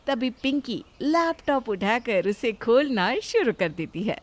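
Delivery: normal talking voice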